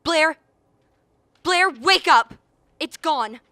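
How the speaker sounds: loud, high alarmed shouting. Acted. Crewman shouting Blair awake after the creature escapes, alarmed yelling. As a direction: yell him awake, the thing is loose.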